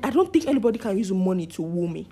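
usual speaking voice

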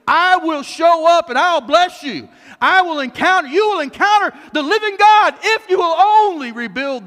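high, loud, building promise; full-force preaching. Acted. Preacher voicing God's promise to altar builders, full-force. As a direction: proclaim it with mounting force.

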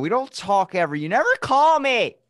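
high, loud mock whining complaint; hammed-up wailing reproach; upset girlfriend. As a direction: play the hurt, neglected girlfriend. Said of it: "a fucking upset girlfriend".

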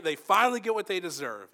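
hammed-up satisfaction; mock triumph. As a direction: play at relishing someone's comeuppance. Acts out gloating at someone caught.

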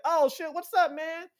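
happy greeting. Retold or imitated. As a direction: greet a fan warmly. Singer greeting happily.

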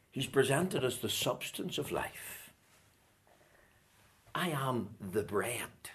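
low, soft voice after the climax; hushed solemn preaching. Real, not acted. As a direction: let it sink in quietly.

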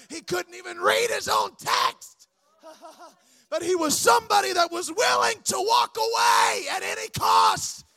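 rising, strained preaching shout; impassioned cry. Real, not acted. Preacher praising one willing to walk away, impassioned cry.

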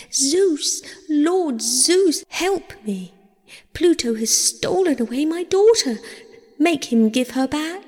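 high, urgent pleading cry; desperate appeal. A narrator's reading. Demeter at heaven's gates begs Zeus, high urgent plea. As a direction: cry out to a god for help.

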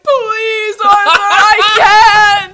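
loud, high desperate plea; desperate pleading. Retold or imitated. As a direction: beg with hammed-up despair. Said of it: "And he's like".

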